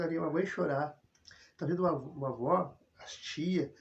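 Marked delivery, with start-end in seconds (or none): none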